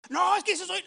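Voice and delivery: loud, high self-justifying retort; mock shouting. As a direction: imitate denying it while yelling. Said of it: "me estás gritando".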